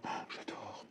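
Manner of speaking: breathy whisper of delight; hushed little gasp of love for the colour. Real, not acted. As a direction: whisper it as if to yourself. Admiring the new colour.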